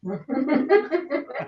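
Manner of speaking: laugh. Real, not acted. Listener amused at the speaker's partial self-control, laughing.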